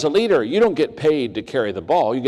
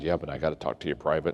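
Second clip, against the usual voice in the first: low, quiet, quick confidential aside; hushed confidential; 7 semitones lower, 9 dB quieter, faster than usual. Retold or imitated. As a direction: lean in and say it under your breath. CEO's quiet private confession.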